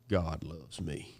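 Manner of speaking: low, slow and soft, each word weighed. Retold or imitated. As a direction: say it with grave, hushed conviction. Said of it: "looked me dead in the eye".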